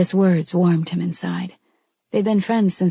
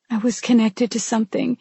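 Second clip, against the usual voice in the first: higher, voice cracking with grief; voice breaking; 5 semitones higher than usual. A narrator's reading. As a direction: let the voice break on it. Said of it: "her voice breaking".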